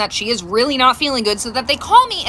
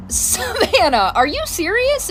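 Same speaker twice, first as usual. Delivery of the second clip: disbelief; 8 semitones higher, slower than usual. Retold or imitated. Mom reacts to faked illness.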